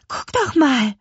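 put-on high, loud, thrilled girl; excited. A narrator's reading. Lea nudges her brother, excited at the witch. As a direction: point it out in a rush of excitement. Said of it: "Aufgeregt stieß Lea".

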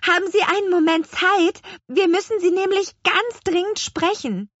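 put-on a little girl pressing her case; urgent girl voice. Lea begging old woman for time, high urgent.

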